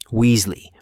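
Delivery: loud, stern call across the corridor; shouting a name. A narrator's reading. Teacher calling out pupils in the corridor, loud. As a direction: bark the name to stop them. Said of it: "McGonagall's voice could have shattered glass".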